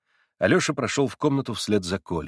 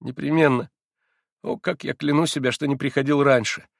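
tearful; 5 semitones higher than usual. A narrator's reading. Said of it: "Плача и уже не конфузясь".

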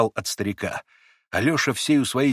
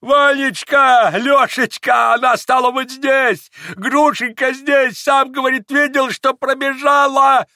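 very high, loud, drawn-out squeal; old man beside himself with excitement; gushing frenzy; 13 semitones higher, 6 dB louder, slower than usual. A narrator's reading. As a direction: cry out feverishly, stretching every name. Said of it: "Он захлёбывался".

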